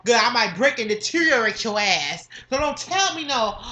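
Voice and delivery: ordinary talk, nothing marked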